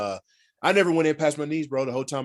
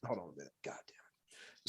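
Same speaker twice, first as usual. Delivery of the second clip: frustrated whispered curse; 11 semitones lower, 23 dB quieter than usual. Real, not acted. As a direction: hiss the curse to yourself.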